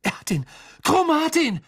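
high, loud cry building in force; excited shout. A narrator's reading. Harry sees Krumm catch the Snitch, excited shout. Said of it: "rief Harry".

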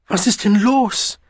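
high, loud alarmed demand; almost shriek the question; panicked rise in pitch and volume; near screech. A narrator's reading. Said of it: "Sie kreischte fast".

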